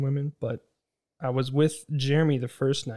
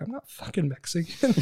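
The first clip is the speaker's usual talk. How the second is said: higher, said through laughter; laughing retort; 5 semitones higher than usual. Retold or imitated. Julian answering the vendor, laughing. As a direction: laugh while snapping back. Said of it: "Julian's like".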